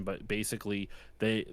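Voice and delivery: usual speaking voice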